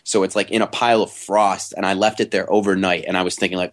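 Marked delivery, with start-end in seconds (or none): none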